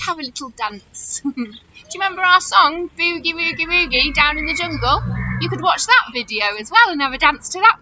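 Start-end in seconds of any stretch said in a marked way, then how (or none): none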